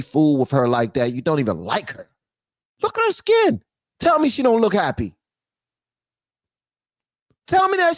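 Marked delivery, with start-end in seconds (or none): 2.8-8.0 high, teasing urging; high teasing coax. Real, not acted. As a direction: coax the ladies playfully up high. Urging viewers to look.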